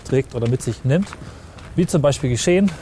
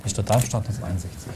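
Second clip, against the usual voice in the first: solemn closing line; 2 semitones lower than usual. Acted. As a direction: read the last line with weight. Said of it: "Schlusssatz bringen". (feierlich vorgetragen)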